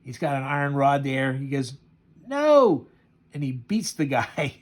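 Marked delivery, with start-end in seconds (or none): 2.3-2.9 1 shouted no. Retold or imitated. Beating the robber with rod.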